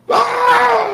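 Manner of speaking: high shrieking mock scream. Imitating a driver startled by a cicada, high shriek. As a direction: shriek like the driver who saw a bug. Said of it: "Like, like".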